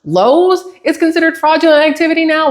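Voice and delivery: heated incredulous question, climbing high in pitch; indignant customer challenging an absurd claim. Retold or imitated. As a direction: retell it in rising disbelief, drawing out "Lowe's". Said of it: "I was so heated".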